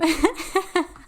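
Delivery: bright laughing burst; short real laugh. Real, not acted. Host giggling at her own tangent. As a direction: let a laugh escape.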